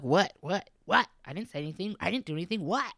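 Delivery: rapid, rising what-what-what, playing innocent; mock offended teenager protesting; defensive teen. Mom imitating a teen denying any attitude.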